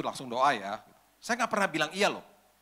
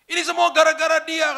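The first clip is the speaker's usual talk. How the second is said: crying; 14 semitones higher, 12 dB louder than usual. Retold or imitated. Girl suddenly breaks down about her father, crying loudly. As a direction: sob out the grudge. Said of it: "Sambil nangis-nangis".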